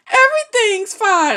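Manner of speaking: high, loud put-on exclamation; mock cheery caption. Reader voicing the Ten of Swords card, mock high exclamation. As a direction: sing it out high and loud, insisting.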